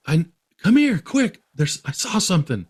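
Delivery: high, loud, urgent summons; urgent call. Retold or imitated. Boy urgently calls his brother to come see, high and loud. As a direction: hurry him over, keyed up.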